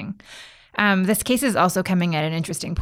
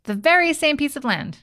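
surprised; 7 semitones higher than usual. Real, not acted. Host echoes surprising fact.

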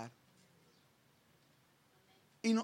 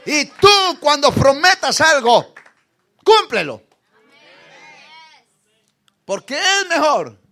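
full-force preaching; 4 semitones higher, 21 dB louder than usual. Acted. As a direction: thunder it out to the congregation.

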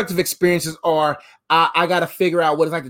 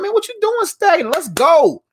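much higher, excited push to get moving; hype friend pushing; 11 semitones higher, 3 dB louder than usual. Retold or imitated. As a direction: fire him up like a friend who won't let him sit still. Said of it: "People gonna be like".

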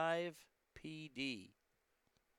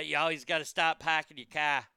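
hammed-up Boston accent, dropping the R's; terrible Boston accent; 6 dB louder than usual. Shown as a demonstration. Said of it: "I do a terrible Boston accent".

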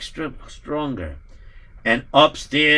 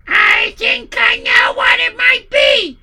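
shrill wife voice; 7 dB louder, slower than usual. Acted.

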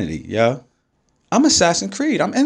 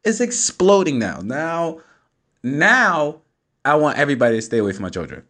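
normal talking voice

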